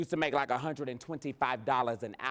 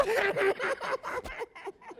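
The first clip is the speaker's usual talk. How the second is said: high burst of laughter; long, high delighted cackle; laughing. Real, not acted. Bursts out laughing after boast.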